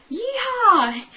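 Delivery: high, loud cowboy holler; long, high rodeo-style yell; cowboy yeehaw. Acted. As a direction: whoop it out like a cowboy. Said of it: "like all the cowboys do usually".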